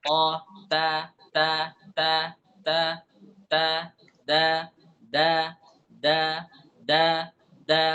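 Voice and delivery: ta and da said sharply from the tongue tip; letter drill. Shown as a demonstration. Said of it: "ujung lidah dengan tempat tumbuh gigi atas".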